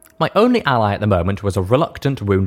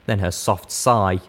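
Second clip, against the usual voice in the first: plain reading voice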